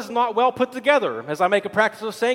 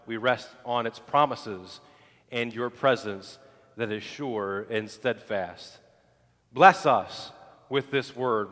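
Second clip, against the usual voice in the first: lower, measured praying voice with pauses; pastoral prayer; 7 semitones lower, 4 dB quieter than usual. Real, not acted. Pastor leading his congregation before the sermon, prayerful.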